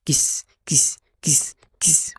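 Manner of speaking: X said as "كيس", not "إكس"; teacher sounding out "كيس" for X; phonics sound for X. Shown as a demonstration. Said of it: "أمال بيتنطق".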